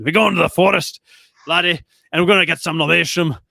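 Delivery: hammed-up Scottish brogue; exaggerated mock Scottish accent; heavy Scottish accent. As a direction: play a jovial Scot calling out. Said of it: "like, a heavy Scottish accent".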